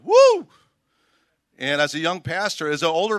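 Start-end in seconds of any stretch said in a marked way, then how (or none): 0.0-0.5 high, loud startled whoop; shocked woo. Retold or imitated. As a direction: let out a sudden yelp of shock at the swearing. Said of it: "And I'm going".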